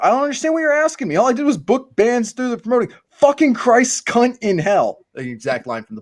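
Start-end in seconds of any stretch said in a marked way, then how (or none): 0.0-2.9 Vanessa's reply. A narrator's reading. Vanessa voiced in book reading. 3.1-5.0 Sid's angry outburst. A narrator's reading. Sid's outburst read aloud.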